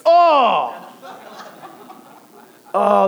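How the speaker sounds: high loud laughing cry of horror; mock-horrified, laughing; dramatic dismay. Real, not acted. Telling the congregation the nugget got touched, loud mock horror.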